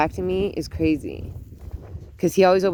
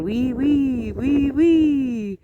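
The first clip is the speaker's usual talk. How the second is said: higher mock French oui oui; lightly playful French chant; 7 semitones higher, much slower than usual. Grandma imagining grandson repeating French all day. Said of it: "running around all day saying".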